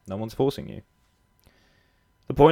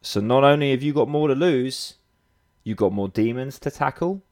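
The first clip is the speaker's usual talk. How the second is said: ordinary delivery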